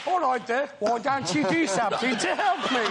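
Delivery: botched comic-duo impression with Brummie vowels; put-on exasperated complaint to a partner; hammed-up double-act voice; Oliver Hardy. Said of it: "you could do Laurel and Hardy".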